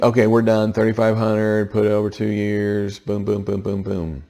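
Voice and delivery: loud, cocky, pleased with himself; mock triumph. To the class, mocking a borrower sure he's done, loudly cocky. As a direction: crow like it's all settled, then tap it off. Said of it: "So you would think".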